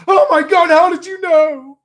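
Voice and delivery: high, loud mock gasp of disbelief; a hammed-up caught-out neighbor, high and loud; shocked neighbor. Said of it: "And he'd be like".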